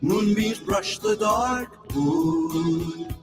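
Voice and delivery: normal talking voice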